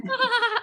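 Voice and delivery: high, bursting laugh to shake off a bad call; squeaky relieved giggle after being yelled at; retold laugh. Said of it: "I'd just start laughing".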